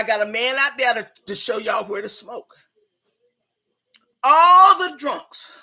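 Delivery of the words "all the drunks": loud, slow, higher-pitched announcement; calling out. Acted. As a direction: holler it slowly to single out a group. Host calling out the drunks.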